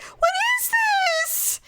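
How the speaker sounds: very high, slow, drawn-out what is this; stunned mom. Retold or imitated. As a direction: stretch it out in wonder. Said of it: "she's just like".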